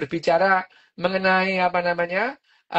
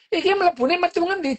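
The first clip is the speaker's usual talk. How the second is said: Solo Javanese; 10 semitones higher than usual. Retold or imitated. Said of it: "orang Solo ngomongnya".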